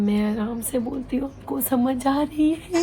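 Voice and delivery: very slow, soft drawn-out demo; mock the request by speaking at a crawl; slow and soft to show calm speech; exaggerated slow talk. Shown as a demonstration. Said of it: "आप थोड़ा सा आराम से बोला करें".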